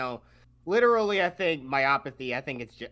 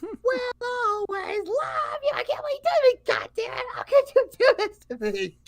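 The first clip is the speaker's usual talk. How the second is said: very high, strained shrieking sob of rage; JonTron crying with rage; 5 dB louder than usual. Retold or imitated. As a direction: wail it out, betrayed and furious.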